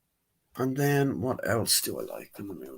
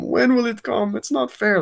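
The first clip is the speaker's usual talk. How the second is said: high, louder complaint; mock child protest; 13 semitones higher, 5 dB louder than usual. Young Diana asking when her time comes, protesting. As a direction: imitate an impatient child protesting.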